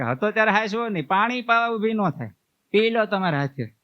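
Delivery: hammed-up village granny telling someone off; scolding old woman. Old village woman chides a grieving widow, in mock scolding. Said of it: "પછી ડોશીમાં બોલ્યા".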